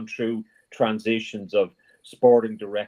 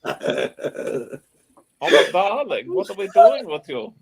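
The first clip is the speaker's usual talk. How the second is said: high, loud, put-on foreign character voice; hammed-up comic movie character; film character impression; 10 semitones higher, 4 dB louder, slower than usual. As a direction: gush in a high mock European accent. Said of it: "You sa-, you sound like".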